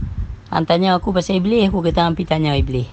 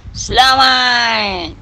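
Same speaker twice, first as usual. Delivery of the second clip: "Selamat" higher and stretched very slowly; drawn-out selamat; 7 semitones higher, 3 dB louder, much slower than usual. Shown as a demonstration. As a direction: sing out a slow, high "Selamat". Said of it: "Okey, nah". ("Selamat" dipanjangkan dengan nada tinggi)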